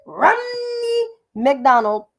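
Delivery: Romney drawn out slowly, stretched as RUHN-nee McDonald; how she said it. Retold or imitated. Mocking the chairwoman's own name: Romney McDonald stretched slowly. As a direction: say Romney McDonald slow with a long doubled n. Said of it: "that's how she said".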